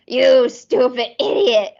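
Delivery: lightly voiced furious Ren, hammed up; Ren super mad. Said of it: "when he gets super mad, he goes".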